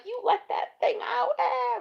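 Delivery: shrill, very high shocked question; horrified disbelief. Retold or imitated. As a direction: shriek it in disbelief. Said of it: "I'm like".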